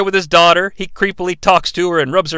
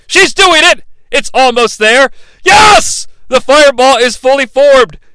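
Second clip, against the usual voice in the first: rising, louder hype to a high shout; building excitement; 5 semitones higher, 3 dB louder than usual. Real, not acted. Narrator hyping the fireball, excited build to a shout. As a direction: cheer the fireball on like a fan.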